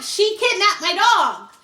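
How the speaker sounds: high, drawn-out mock outrage; indignant complaint. Calling the police about the stolen dog, indignant.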